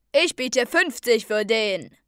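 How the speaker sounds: loud, slow bidder's call; called-out bid. A narrator's reading. Another bidder in the crowd, loud drawn-out call. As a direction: outbid them with a drawn-out shout. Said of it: "rief wieder einer".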